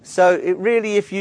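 usual speaking voice

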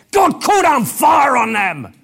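loud, high, slow, indignant; angry demand. Retold or imitated. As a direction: demand revenge, offended. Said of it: "they say".

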